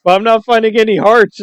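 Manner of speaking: high, baffled mock gamer whine; confused player voice. Retelling sketch, confused player character.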